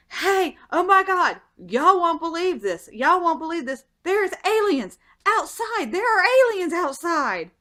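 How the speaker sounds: high-pitched, breaking the unbelievable news; frantic alarm. Retold or imitated. Telling the household about aliens outside, high frantic alarm. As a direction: raise the alarm, high and insistent.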